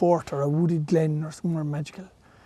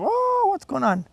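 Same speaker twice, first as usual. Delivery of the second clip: drawn out high wondering; storyteller voices the gathered animals, high and slow; high, slow puzzled animal voice; 12 semitones higher, slower than usual. Retold or imitated. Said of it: "you'd hear this kind of".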